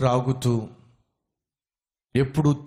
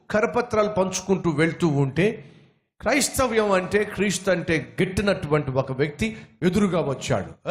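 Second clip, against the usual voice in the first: ordinary talk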